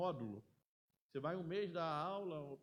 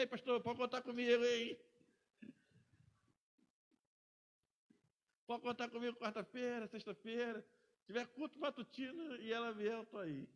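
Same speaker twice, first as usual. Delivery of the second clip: eager; 6 semitones higher than usual. Retold or imitated. Eager young man volunteering.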